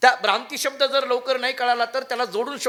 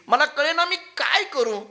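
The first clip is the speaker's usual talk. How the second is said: uneasy disciple; 6 semitones higher, slower than usual. Acted. Disciple to guru, anxious plea for help. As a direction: beg the guru, lost for what to do. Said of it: "तो अस्वस्थ झाला".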